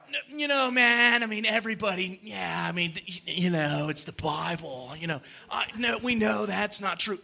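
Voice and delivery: hammed-up noncommittal dodging; mock evasive skeptic. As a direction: imitate a vague skeptic, soft and hesitant. Mimics evasive skeptic dodging the question.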